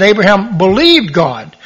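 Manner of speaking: "believed" pushed up in pitch; stress on believed. Shown as a demonstration. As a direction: contrast it by leaning on "believed". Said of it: "Doesn't say Abraham did works".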